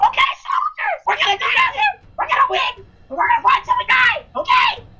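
shouted pep talk to the troops; rally the soldiers at full volume; rousing shout. Pretend. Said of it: "Henry's gotta give us a motivational speech".

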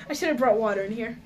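usual speaking voice

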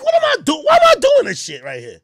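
high, loud disgusted cry; mock self disgust. Catching yourself in the mirror, with a loud high disgusted cry. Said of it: "You're like".

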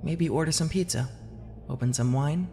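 a tender wife's soft suggestion; caring wife. Acted. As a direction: tempt him gently into a night off.

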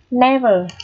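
"Never" with a clear short E in the first syllable; E sound. Shown as a demonstration. Teacher demonstrates spelled E read as E, clearly modelled. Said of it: "phải phát âm là âm E".

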